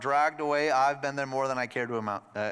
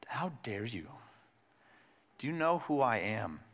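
hushed indignation; 2 semitones lower, 5 dB quieter than usual. Pretend. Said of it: "what he doesn't say is".

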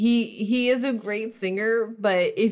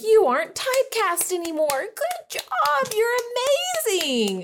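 high, gushing mock praise; mocking Hollywood praise. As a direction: gush it up high like fake applause. Mocking Hollywood's self-congratulation.

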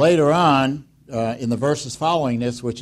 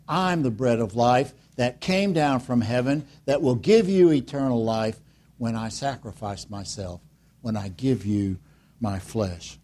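ordinary delivery

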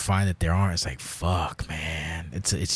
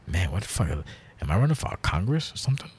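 usual speaking voice

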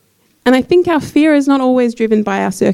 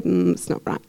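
mock know-it-all; 3 semitones lower, 7 dB quieter than usual. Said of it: "we like to kind of say".

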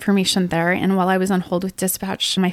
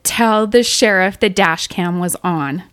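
slow, louder, emphatic retelling of a scream; retold scream; 5 dB louder, slower than usual. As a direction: imitate an insistent yell without fully screaming. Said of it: "He screamed at me in my ear".

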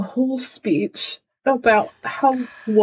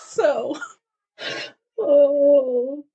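ordinary talk

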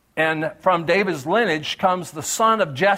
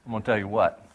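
southern God; 7 semitones lower, 4 dB quieter, faster than usual. Retold or imitated. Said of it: "That's the southern God".

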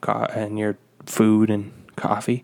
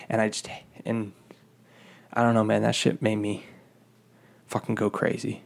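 normal talking voice

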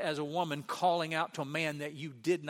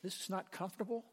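softer acted inner grumble, rising at the ends; thinking aloud; 3 semitones higher, 6 dB quieter than usual. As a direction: voice their uneasy thought softly. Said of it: "And they're thinking".

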